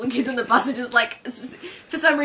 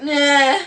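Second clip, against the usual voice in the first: loud, higher bleat; imitated monkey groan; put-on animal whine; monkey noise; 6 semitones higher, 11 dB louder than usual. Imitates monkey keeling over.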